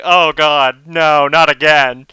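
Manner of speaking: mock dismay. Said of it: "she's like".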